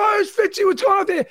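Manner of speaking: high, delighted hello; party guy cheering a buddy; excited greeting. Retold or imitated. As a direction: greet your favorite friend at the door.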